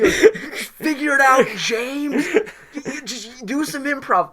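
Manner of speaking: slow, high, laughing through the words; laughing mock director. Host voicing the director telling James to improvise, laughing. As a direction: giggle out the order like a teasing director.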